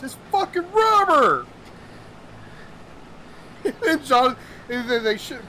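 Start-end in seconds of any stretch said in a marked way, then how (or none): 0.0-1.4 much higher, louder disbelief; indignant discovery. Retold or imitated. Captain finding the fake crabs, indignant. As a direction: realize you've been pranked. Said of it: "He goes".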